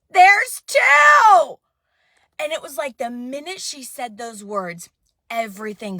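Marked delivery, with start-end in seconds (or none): 0.1-1.6 loud, high "There's two" called out; excited announcement. Retold or imitated. Ultrasound tech telling the mother, loud and excited. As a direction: burst out with the news. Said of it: "And she goes".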